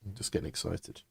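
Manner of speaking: embarrassed quiet; sheepish. Real, not acted. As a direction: pull back after the outburst.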